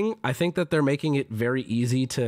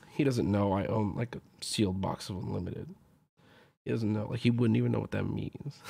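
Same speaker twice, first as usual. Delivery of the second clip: low, quiet aside to yourself; mock-secretive thought, dropped soft and low; muted inner monologue; 5 semitones lower, 6 dB quieter than usual. Retold inner thought from meme.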